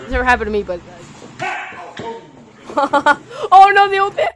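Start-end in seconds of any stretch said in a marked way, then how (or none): 1.4-4.3 laughing. Real, not acted. Laughing alarm at clip.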